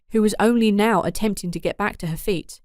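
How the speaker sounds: usual speaking voice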